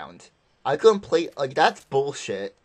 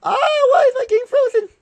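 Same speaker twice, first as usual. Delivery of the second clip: shrill startled shriek, much higher and louder; startled scream; 9 dB louder than usual. Real, not acted. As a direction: scream in sudden shock. Sudden scream at frozen game.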